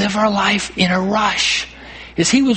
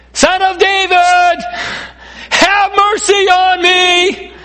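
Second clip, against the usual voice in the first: high, drawn-out shout over a crowd; shouting blind beggar; 11 semitones higher, 5 dB louder, slower than usual. Retold or imitated. Blind beggar calling to Jesus through the crowd, shouting. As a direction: cry out for mercy at full voice. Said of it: "shouting at the top of his lungs".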